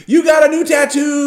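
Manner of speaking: high, loud excited shout. Real, not acted. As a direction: announce it with a thrill. Excited announcement.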